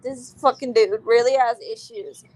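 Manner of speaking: ordinary talk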